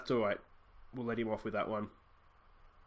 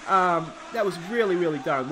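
louder, higher, still buzzing; still overexcited; 5 semitones higher, 8 dB louder than usual. Real, not acted. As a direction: gush it out, can't calm down. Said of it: "I'm too excited, I can't even speak".

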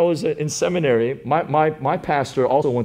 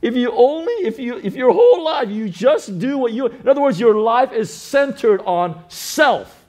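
high, loud preacher's cry; preaching at full force; 11 semitones higher, 6 dB louder than usual. Acted. Pastor to church, preaching at full force. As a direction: drive it home to the congregation.

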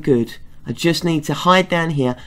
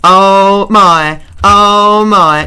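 loud startled repeated oh my; alarmed oh my; 8 dB louder, much slower than usual. Real, not acted. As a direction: blurt it out in real alarm. Startled by a witch in game.

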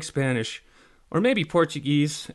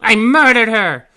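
high, very loud, drawn-out yell; muffled fairy yell; 10 semitones higher, 15 dB louder, slower than usual. A narrator's reading. Tink continues from inside pirate, muffled yell. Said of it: "continued in a muffled voice".

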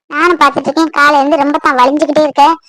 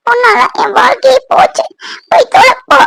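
high, anguished outburst; angry dismissal; 6 semitones higher than usual. Acted. Woman says he ruined her life, furious. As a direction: pitch rising as you drive him away. Quarrel, sends him away.

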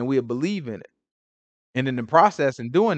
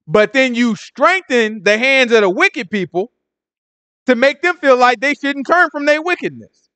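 full-force preaching; 11 semitones higher, 6 dB louder than usual. Real, not acted. Preacher hammering the verse home to listeners, full-force preaching. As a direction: drive each word home like a street preacher.

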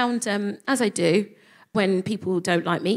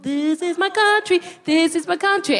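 proud declaration; 8 semitones higher than usual. Retold or imitated. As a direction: claim the land with mock pride. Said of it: "So I turned up like".

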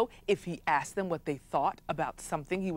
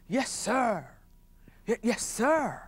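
fawning courtiers; 4 semitones higher, slower than usual. Acted. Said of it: "all of the wise men said".

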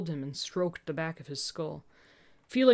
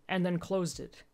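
ordinary delivery

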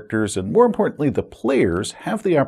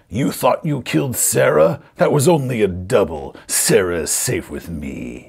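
gloating villain; 3 semitones lower than usual. Acted. Perez reveals to players that Sarah lives, gloating villain. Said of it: "Perez laughs".

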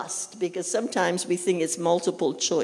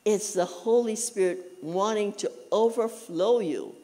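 ordinary talk

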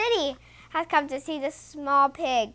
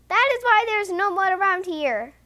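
higher-pitched storybook complaint; understated exclamation of dismay; mild cry; 5 semitones higher, 3 dB louder than usual. A narrator's reading. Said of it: "cries the small pig".

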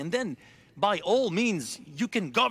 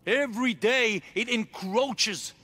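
ordinary talk, nothing marked

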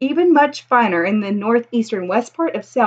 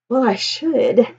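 usual speaking voice

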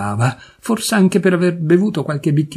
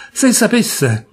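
a choked-back cry of se sapesse; pleading rise; 7 semitones higher, slower than usual. A narrator's reading. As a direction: lift se sapesse into a higher plea. Repeated plea, second take.

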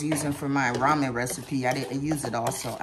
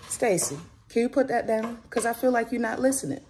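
raised, stern-ish mom voice; gentle scolding; 8 semitones higher than usual. Real, not acted. Mom telling her son to put the tablet down, mildly stern. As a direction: call him back to attention.

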